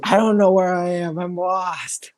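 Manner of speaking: high, wailing grandma caricature; imitated lost grandma. Imitating grandma phoning home lost, high helpless voice. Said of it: "she's like".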